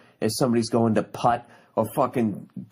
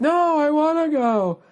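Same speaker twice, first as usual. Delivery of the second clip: much higher, slow whiny mock-wife voice; 3 dB louder, slower than usual. Husband mimics his wife begging to go to Wimbledon, high mocking whine. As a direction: whine it out like a prissy wife who wants to dress up. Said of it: "And she'll be like".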